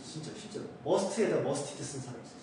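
ordinary delivery

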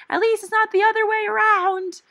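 high, loud retort across the room; called across room. Retold or imitated. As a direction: fire it back across the cubicle. Shouted across the cubicle.

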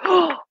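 high, sharp intake of breath; amazed gasp. Real, not acted. Seeing the paper designs, amazed gasp. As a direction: gasp in delight.

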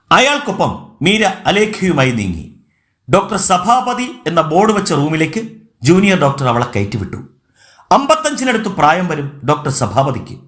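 ordinary delivery, nothing marked

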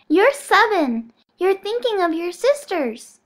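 put-on high, slow, patient child voice; little girl voice. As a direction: correct him slowly like a child. Bonesaw correcting slowly.

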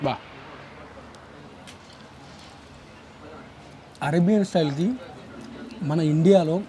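chewing between words; talking with food in mouth; mouth full. Real, not acted. Seen 0: Bites food at -7; lips close between words, cheeks look full.

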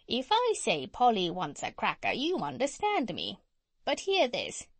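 talking parrot with swooping pitch; Polynesia. Acted. Parrot preparing to show the doctor, swooping bird voice. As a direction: demonstrate like a knowing bird.

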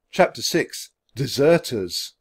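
usual speaking voice

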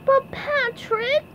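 high, drawn-out cartoon plea; begging. A narrator's reading. SpongeBob pleading as Patrick leaves, high begging. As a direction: beg slowly in a very high voice. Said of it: "SpongeBob begged".

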